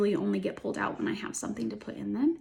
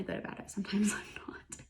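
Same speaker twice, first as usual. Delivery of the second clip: whispered aside; 10 dB quieter, faster than usual. Real, not acted. Joking aside about own habits.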